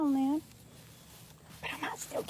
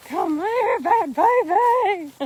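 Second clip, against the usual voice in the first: teasing call; 7 semitones higher, 7 dB louder, much slower than usual. Acted. Playful chase of an animal.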